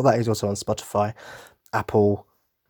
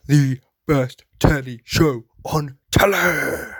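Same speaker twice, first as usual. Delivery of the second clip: higher, very slow sing-song on the final words; sing-song catchphrase; 4 semitones higher, 3 dB louder, much slower than usual. Acted. Host returning listeners to the topic, sing-song and slow. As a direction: stretch the last words up like a jingle.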